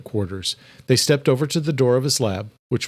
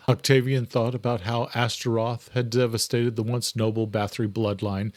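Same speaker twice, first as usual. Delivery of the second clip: ordinary delivery, nothing marked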